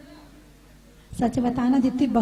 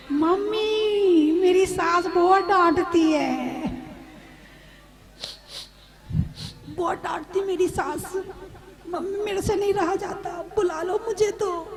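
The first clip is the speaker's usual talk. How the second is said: whiny daughter-in-law; 3 semitones higher than usual. Retold or imitated. New bride complains to mother.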